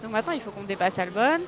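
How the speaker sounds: ordinary talk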